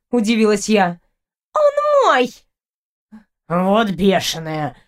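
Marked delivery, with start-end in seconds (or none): 1.5-2.3 very high, indignant claim; irate girl, pitched far up; possessive outburst. A narrator's reading. Rival girl claims the cat. 3.5-4.8 low, slow, appalled cat voice; affronted low creature; indignant cat. A narrator's reading. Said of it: "возмутился котик".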